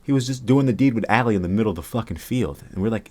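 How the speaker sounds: ordinary talk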